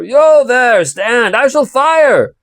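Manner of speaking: high, loud shouted challenge; threatening shout. Acted. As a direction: bark the warning at the stranger. Guard challenges the rider.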